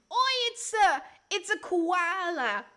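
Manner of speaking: broad, stretched mock Australian accent. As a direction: play an excited cartoon Australian, stumbling at the end. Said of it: "See, I know Australian".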